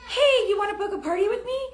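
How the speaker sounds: high, fast, breezy cold-message voice; mock spammy pitch. Seller mocking a spammy cold message, breezy high pitch. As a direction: toss it off like a pushy stranger. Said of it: "And you just say".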